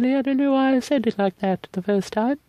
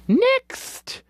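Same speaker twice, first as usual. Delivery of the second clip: chirpy call; 12 semitones higher than usual. Real, not acted. Moving on briskly.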